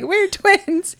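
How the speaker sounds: high, kid-like mock Australian accent; childish fake Australian "We're twins"; bad Australian accent. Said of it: "really bad Australian accent".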